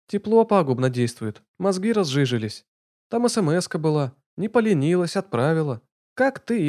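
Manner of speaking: high, sharp-tongued woman teasing; mocking young woman. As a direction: needle him with a teasing voice. Lana's mocking phone voice.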